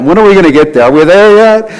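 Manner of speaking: mock child asking are we there yet; whiny child. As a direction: whine it like a bored child on a road trip. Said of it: "Those of you that are parents have heard that".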